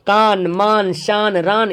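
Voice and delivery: कान मान शान रान with a crisp closing न; clear final n. Shown as a demonstration. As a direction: land hard on the न. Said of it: "पूरा clear आ रहा".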